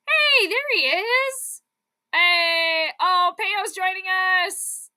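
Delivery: slow, high cheery hello; excited greeting. Real, not acted. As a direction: greet the newcomer with delight. Greeting a stream raid.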